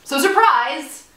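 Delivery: spring the news with glee; excited reveal. Real, not acted. Announcing MTV appearance.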